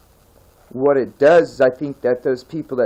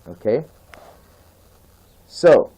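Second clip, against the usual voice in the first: usual speaking voice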